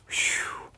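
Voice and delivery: breathy whisper of relief; whispered whew. Real, not acted. Teller sighing relief that Santa loves suit, whispered whew.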